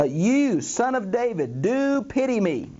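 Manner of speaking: slow, drawn-out cry rising high; imitated shouting. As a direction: call out insistently, stretching each phrase. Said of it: "he kept shouting".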